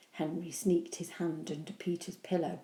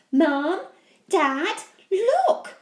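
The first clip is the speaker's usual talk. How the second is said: excited; 11 semitones higher, 10 dB louder, much slower than usual. Acted. Peter excited calling out.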